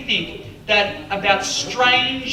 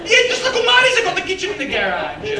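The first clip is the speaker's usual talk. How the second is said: high, louder Italian mum; mum's voice; 13 semitones higher, 5 dB louder than usual. Retold or imitated. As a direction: announce it like a proud mother. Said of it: "mum answering".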